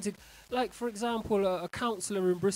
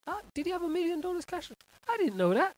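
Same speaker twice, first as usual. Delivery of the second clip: surprised; 10 semitones higher than usual. Real, not acted. Surprised aside to self.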